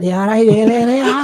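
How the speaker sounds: hammed-up Scouse accent. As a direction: squeak it like a Liverpudlian. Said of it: "when you hear the Scouse accent".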